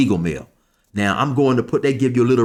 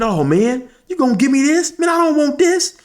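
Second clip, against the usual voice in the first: high, put-upon whine, much higher; pitched-up mock scoff at a cheap payoff; mock whiny complaint; 3 dB louder than usual. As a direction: imitate him turning his nose up at the soap. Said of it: "he said".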